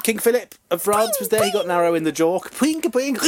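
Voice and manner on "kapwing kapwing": higher playful kapwing; longbow twang. Retold or imitated. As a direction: twang the arrow as it flies at the king. Retelling the king shot in jaw.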